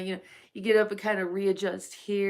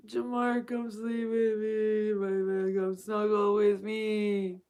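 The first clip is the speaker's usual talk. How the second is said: cooing. Pretend. Cooing invitation to snuggle.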